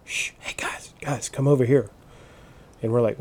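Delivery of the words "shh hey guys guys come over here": urgent hushed whisper; shushing whisper. Retold or imitated. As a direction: whisper so the deer won't hear. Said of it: "kinda shushes us and goes".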